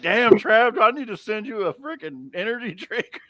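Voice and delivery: mock exclamation. Friend teasing Travis about looking tired, mock exclamation. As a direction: tease with loud, high mock concern.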